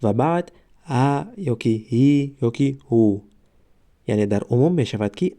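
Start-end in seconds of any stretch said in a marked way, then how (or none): 0.0-3.2 "ا" "هی" "هو" drawn out slowly; teacher sounding out vowels slowly; slow vowel demo. Shown as a demonstration. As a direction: stretch out each vowel to show the breath onset. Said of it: "همین آوازها یکمشان اه دارند".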